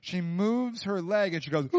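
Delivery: usual speaking voice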